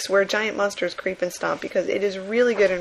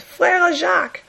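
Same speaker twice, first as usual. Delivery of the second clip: "Frère Jacques" high, slow and loud in cartoon French; mock French voice; 10 semitones higher, 4 dB louder, slower than usual. Comic bystander naming a nursery song, mock French. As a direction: croon it out like a stage Frenchman. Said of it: "another one says".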